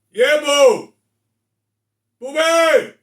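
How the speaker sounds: loud, high call across the house; yelling back to his wife who called. Real, not acted. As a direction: shout toward the other room. Calling out to his wife offscreen.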